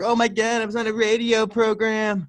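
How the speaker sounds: put-on high, giddy drunk who just got on radio; excited drunk. Drunk on the beach, thrilled to be on the radio: high giddy voice. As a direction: squeal it like a thrilled tipsy beachgoer. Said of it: "when drunks are like".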